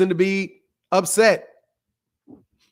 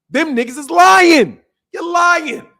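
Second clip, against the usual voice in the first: loud, slow, high angry shout; furious slow shout of accusation; 9 semitones higher, 5 dB louder, slower than usual. Real, not acted. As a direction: yell it out at the liars. Sudden outburst at lying fans.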